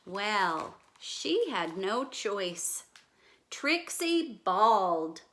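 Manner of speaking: normal talking voice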